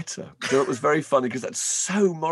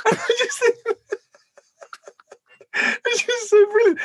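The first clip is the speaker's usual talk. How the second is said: real laughter breaking up the words, pitched up high; laughing. Real, not acted. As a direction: laugh helplessly through the line. Laughing fit over the quote.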